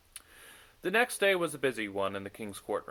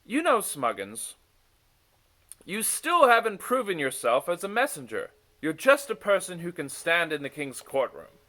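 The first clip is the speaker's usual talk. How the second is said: higher, louder mocking sneer; 7 semitones higher, 7 dB louder than usual. A narrator's reading. Dagon taunts Smuggins about proving himself, sneering. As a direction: look down your nose at him. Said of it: "Dagon sneered".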